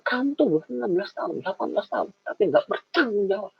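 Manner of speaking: angry scolding. Retold or imitated. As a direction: tell off the teenager hard and fast. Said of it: "Saya marah".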